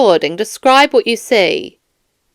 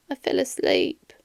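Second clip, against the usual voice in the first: much softer, a shamed confession under the breath; ashamed mumble; 12 dB quieter than usual. Acted. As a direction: barely get it out, embarrassed. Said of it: "Sorry, I didn't catch that".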